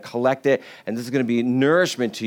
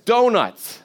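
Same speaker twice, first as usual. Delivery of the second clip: ordinary talk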